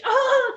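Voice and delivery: squeaky fake laugh, very high; mock high-pitched laugh. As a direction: imitate their shrill little laugh. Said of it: "they laugh with a high pitch".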